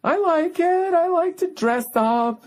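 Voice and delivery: mocking wife. Husband mimics his wife begging to go to Wimbledon, high mocking whine. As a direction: whine it out like a prissy wife who wants to dress up. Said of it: "And she'll be like".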